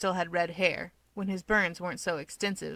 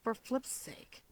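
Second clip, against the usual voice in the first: much softer, slow exasperated mutter; 3 semitones higher, 9 dB quieter, slower than usual. Acted. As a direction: grumble it under your breath, fed up. Toya exasperated.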